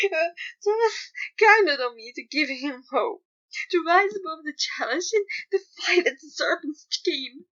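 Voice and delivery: sobbing and begging to be told he was right. Acted. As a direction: cry it out, pleading and breaking. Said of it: "he was sobbing".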